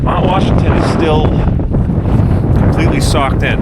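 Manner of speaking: shouting over wind, freezing cold; cold and windy. Real, not acted. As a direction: talk loud against cold wind. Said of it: "I'm freezing my balls off".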